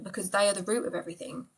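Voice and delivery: ordinary talk, nothing marked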